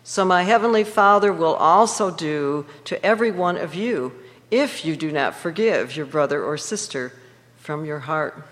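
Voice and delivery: usual speaking voice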